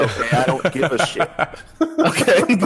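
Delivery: laughing mock dismissal. Mocking customer, breaking up laughing.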